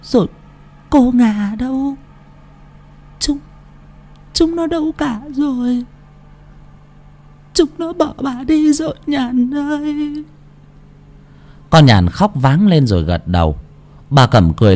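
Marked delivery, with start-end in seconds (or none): 0.0-10.2 faint broken phrases climbing into a high, thin wail; dying grandmother's halting voice, long gaps between words; feeble, gasping old woman. A narrator's reading. Sick grandmother asking where her children went, feebly gasping. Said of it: "đôi môi khô nứt nẻ thều thào".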